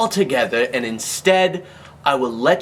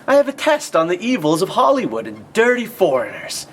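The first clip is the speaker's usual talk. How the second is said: hammy staged customer; 8 semitones higher than usual. Acted.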